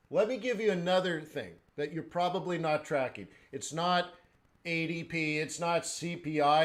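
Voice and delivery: normal talking voice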